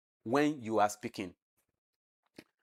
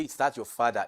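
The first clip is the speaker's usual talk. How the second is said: "Is that your father" with a lowered ending; falling tone. Shown as a demonstration. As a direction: bring the pitch down on "father". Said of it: "it is falling tone".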